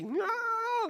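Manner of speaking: a short, very high mock cry of panic; mock panicky yelp. Preacher mocking the congregation's panic, a high yelp. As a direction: squeak out the panic like a frightened person.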